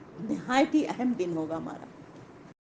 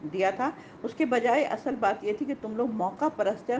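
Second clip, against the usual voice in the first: usual speaking voice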